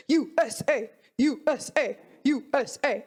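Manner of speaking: measured mock crowd chant; lightly imitated USA chant; Team USA fans. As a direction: chant like a US supporter, restrained. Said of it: "Let me do my impersonation".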